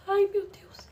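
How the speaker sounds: voz aguda e alta, negando em pânico; desespero chorado. Retold or imitated. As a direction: imita a cunhada gritando não em choque. Cunhada recebendo a notícia da morte. (cunhada em desespero ao saber da morte, chorando alto)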